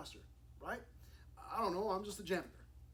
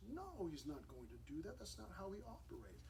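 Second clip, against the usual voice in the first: ordinary talk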